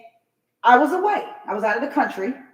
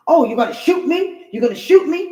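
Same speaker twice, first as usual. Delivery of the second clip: mock indignation; 6 semitones higher, 6 dB louder than usual. Mocking the neighbor's indignant reply.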